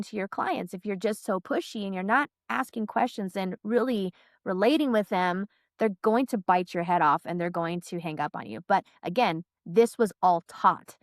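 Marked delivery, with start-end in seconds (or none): none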